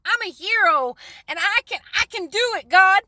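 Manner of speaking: high, loud cocky brush-off; high, loud mock bravado; cocky self. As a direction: brush God off like a swaggering kid. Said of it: "And I'm like".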